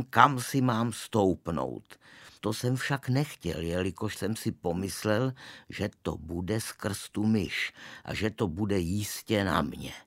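plain reading voice

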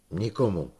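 put-on flat denial to the old man; firmly. A narrator's reading. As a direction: insist on Nikomu. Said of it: "řekl důrazně Igolén".

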